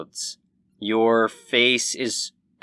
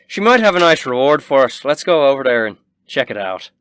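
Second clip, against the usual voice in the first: loud, quick character voice, pitched up; raised, fast in-character delivery; Quinn; 4 semitones higher, 9 dB louder, faster than usual. Acted. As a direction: redo the line brisk and eager to go. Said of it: "Quinn says".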